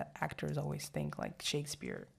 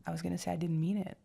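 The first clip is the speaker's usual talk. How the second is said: normal talking voice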